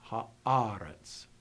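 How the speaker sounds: Haaretz with the stress on the earlier syllable; stress not final. Shown as a demonstration. Teacher demonstrates non-final stress, careful. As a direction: say Haaretz leaning on the middle, not the end. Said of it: "the accent is not in final position".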